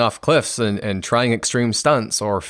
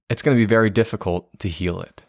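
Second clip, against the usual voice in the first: usual speaking voice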